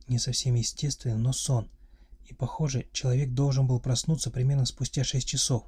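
ordinary delivery

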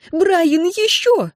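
very high, climbing squeal; shrieking. A narrator's reading. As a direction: urge frantically, pitch shooting up. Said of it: "взвизгнула Энн".

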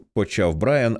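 ordinary delivery, nothing marked

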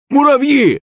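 put-on very high, frantic old man; high-pitched hysterical yelling; spluttering shout. A narrator's reading. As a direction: shriek it high, drawing out the last words. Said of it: "продолжал кричать старик, брызжа слюной".

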